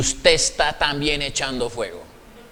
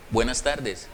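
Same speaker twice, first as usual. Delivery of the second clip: much softer, lower, polite "Buenas tardes"; calm greeting; 7 semitones lower, 8 dB quieter than usual. Acted. Secretary calmly greeting a rude patient, soft and polite. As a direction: answer the rudeness with quiet courtesy.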